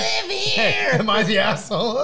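high, indignant challenge; retold indignant outcry. Man interrupted during his interview, indignant outcry. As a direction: pitch it up like an affronted appeal.

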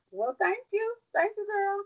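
imitating her own high, sing-song thanks; sweet high thank you. To her friend, high mock-sweet thanks. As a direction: lift the voice way up, playfully grateful. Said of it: "And I'm like".

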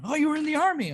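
high, mock-amazed question; Kevin Farley. Comic imitating Kevin Farley learning of his army past, mock amazed. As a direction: pipe up in exaggerated surprise.

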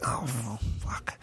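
breathless whispered curse; whispered dismay. Retold or imitated. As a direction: whisper it as the mistake sinks in. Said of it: "And I'm like".

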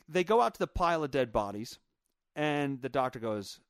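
normal talking voice

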